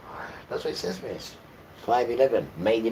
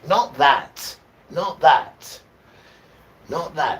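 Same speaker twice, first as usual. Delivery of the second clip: Not that, loud, with NOT punched; emphatic shout; 6 semitones higher, 11 dB louder, much slower than usual. Acted. Preacher to congregation, loud emphatic not that. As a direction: reject it hard and loud. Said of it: "emphatic repeated rejection".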